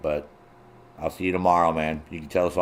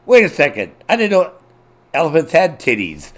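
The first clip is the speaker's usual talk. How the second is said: high, louder puzzled exclamation; mock disbelief; 8 semitones higher, 6 dB louder than usual. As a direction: sound baffled by what you read. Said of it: "I'm like".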